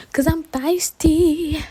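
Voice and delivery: slow, high sassy drawl. Acted. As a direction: flaunt it proudly. Repeats sassy declaration.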